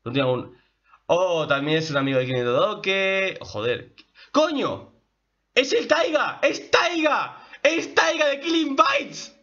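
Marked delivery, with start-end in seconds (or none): 4.3-9.4 very high, excited exclamations; thrilled recognition. Real, not acted. Fan realising the dwarf is Taiga, thrilled. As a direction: burst out squealing at the discovery.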